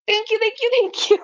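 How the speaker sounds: high, quick repeated thanks; gushing thanks. Real, not acted. Thanking a viewer for the gift, high gushing thanks. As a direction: pour out the thanks, overjoyed.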